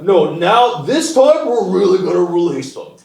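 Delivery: lightly voiced smug official; mock official. Mocking the government's empty promise, hammed-up official voice.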